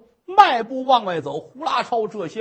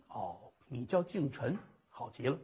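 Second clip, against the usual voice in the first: dry low remark; 11 semitones lower, 16 dB quieter than usual. Acted. Official mocks her name.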